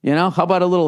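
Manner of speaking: ordinary talk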